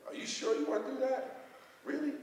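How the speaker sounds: very high-pitched retold disbelief; doubting question. Acted doubting reply to Lee.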